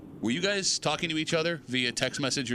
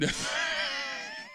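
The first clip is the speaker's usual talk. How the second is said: high shrieking burst of laughter; 6 dB quieter than usual. Real, not acted. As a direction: let out a squealing laugh. Reacting to bad news tease.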